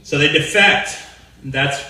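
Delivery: normal talking voice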